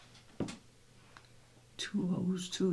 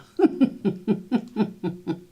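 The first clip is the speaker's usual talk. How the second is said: bright burst of laughter, louder; real laughter; 4 semitones higher, 4 dB louder than usual. Real, not acted. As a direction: laugh freely at the silly word. Laughing at silly word.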